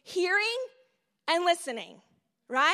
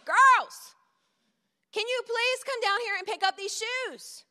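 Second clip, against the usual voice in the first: put-on Girls called out high and loud, then the request at a normal level; louder call; 7 semitones higher, 5 dB louder than usual. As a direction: call them again, now losing patience. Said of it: "this time I say it a little bit louder".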